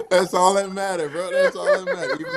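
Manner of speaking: talking through high laughter; helpless laughing agreement. Real, not acted. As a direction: get the words out while cracking up. Laughing at the imitation of the kids.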